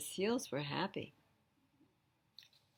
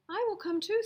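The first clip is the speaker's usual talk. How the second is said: eager seal; 14 semitones higher, 7 dB louder than usual. Acted. Sammy eager for school.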